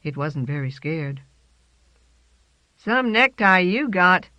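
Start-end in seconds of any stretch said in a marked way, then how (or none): 2.8-4.3 pigeon voice. A narrator's reading. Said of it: "it said".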